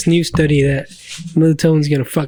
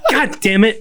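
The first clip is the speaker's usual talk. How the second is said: laughing curse; 5 semitones higher, slower than usual. Retold or imitated. Reacting to Jen moving hands.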